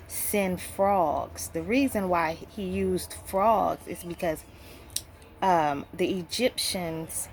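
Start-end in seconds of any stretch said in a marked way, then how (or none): none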